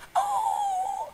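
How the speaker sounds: very high whimpering howl; frightened dog howl. Retold or imitated. Dog scared by a spirit, high frightened howl. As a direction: howl like a dog that saw a ghost. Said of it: "Dia ketakutan lihat malu halus".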